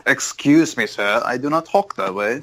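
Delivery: mock-offended in a half British, half Southern accent; failed Southern British accent. Friend mocks the podcast hosts in a botched British-Southern accent. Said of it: "Is that a British one now?".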